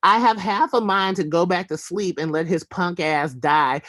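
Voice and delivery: normal talking voice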